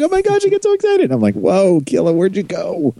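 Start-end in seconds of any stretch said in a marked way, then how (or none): 0.0-1.1 very high imitation of an excited friend; mock excited squeal. Host imitating the co-host getting excited, absurdly high mock excitement. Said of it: "his voice jumps up, like, two or three octaves". 1.3-2.9 high-pitched, playful astonishment; mock surprise. Host reacting to the co-host's voice jump, mock surprise.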